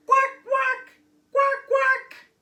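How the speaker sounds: high, loud quacking; duck quack. Acted. Duck replies to Olive, a loud high quack. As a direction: quack back like a cheerful duck.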